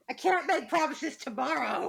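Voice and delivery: ordinary talk, nothing marked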